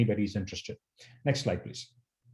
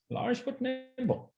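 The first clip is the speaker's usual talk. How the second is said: large but nimble, each word slowed and high; slow emphatic slogan; 11 semitones higher, 6 dB quieter, slower than usual. Acted. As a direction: stretch out "large but nimble" slowly, pitched up and soft.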